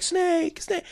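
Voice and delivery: high mock-anguished call; high-pitched hammed-up name cry; game-over cry. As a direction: imitate a video game's despairing radio call. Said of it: "when you get a game over and he's like".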